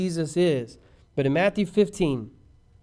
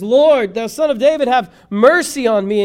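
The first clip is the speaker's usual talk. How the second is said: loud, high plea for mercy; crying out; 6 semitones higher, 9 dB louder than usual. Retold or imitated. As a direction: imitate a desperate woman crying out. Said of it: "she comes and she cries".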